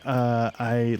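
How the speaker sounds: ordinary talk, nothing marked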